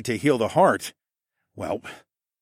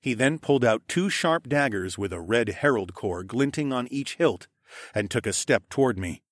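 plain reading voice

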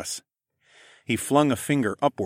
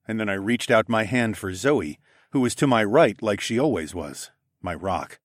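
usual speaking voice

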